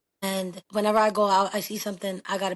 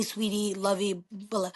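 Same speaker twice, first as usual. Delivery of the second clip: ordinary talk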